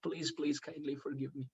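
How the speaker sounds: low, very soft plea; embarrassed apology. Retold or imitated. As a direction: act out a humbled disciple asking forgiveness. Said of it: "very embarrassed".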